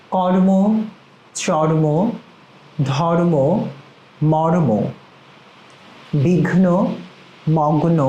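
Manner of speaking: each word said firmly and distinctly, with long pauses between; careful drill words. Shown as a demonstration. Pronunciation teacher drilling a word list for the class, firm and precise. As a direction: model each word crisply and pause for the echo. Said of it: "আমার সাথে সাথে আপনারা কিন্তু সেটি পড়বেন".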